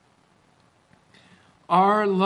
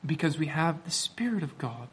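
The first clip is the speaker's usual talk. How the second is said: ordinary talk, nothing marked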